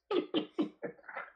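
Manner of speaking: a burst of high, breathy laughter; laughing. Real, not acted. Amused at the dog story, laughing high and breathy. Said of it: "I laughed about that motherfucker".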